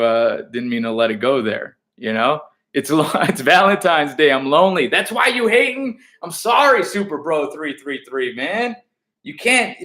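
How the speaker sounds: ordinary delivery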